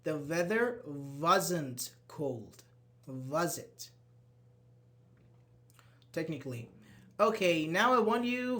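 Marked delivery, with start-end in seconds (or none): 0.0-3.9 "the weather wasn't cold" drawn out slowly; teacher slowing down to show the pattern; slow model sentence. Shown as a demonstration. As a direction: spell it out slowly for students. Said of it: "So here".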